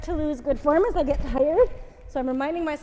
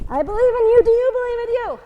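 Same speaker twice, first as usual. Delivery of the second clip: encouraging shout; 9 semitones higher, 11 dB louder than usual. Real, not acted. Coach pushing class in final round.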